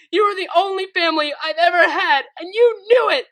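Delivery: high, loud tearful shouting; crying and shouting. Acted. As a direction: shout through tears at her betrayer. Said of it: "crying and shouting".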